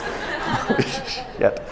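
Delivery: breathless laughing aside; real embarrassed laughter. Real, not acted. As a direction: laugh it out, half voiced.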